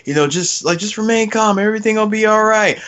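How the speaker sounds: lightly hammed-up calm rescuer; mock reassuring ranger. Ranger to the fallen terrorist, hurried reassurance. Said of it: "he's like".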